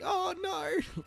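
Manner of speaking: high squeaky cry of dismay; shocked cry. Retold or imitated. Watching the movie's ending, a high shocked cry. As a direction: yelp it out in sudden dismay. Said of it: "I was like".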